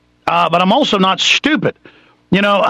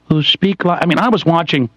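normal talking voice

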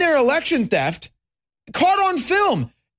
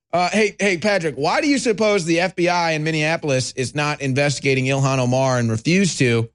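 ordinary talk, nothing marked

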